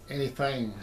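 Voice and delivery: ordinary talk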